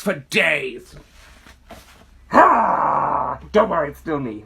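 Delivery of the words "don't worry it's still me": slow, drawn-out spooky voice. Acted. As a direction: draw it out like a ghoul. Behind spooky mask.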